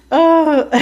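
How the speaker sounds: high, loud laugh; laughing at how absurd it got; real laughter. Real, not acted. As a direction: burst out laughing. Laughing at her own story.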